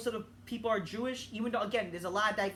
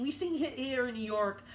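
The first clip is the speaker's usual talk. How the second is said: ordinary talk, nothing marked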